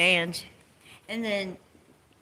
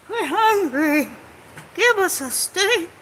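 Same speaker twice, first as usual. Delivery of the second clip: mock whine; 10 semitones higher, 4 dB louder than usual. Mocking protesters' whine.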